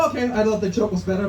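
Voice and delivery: ordinary delivery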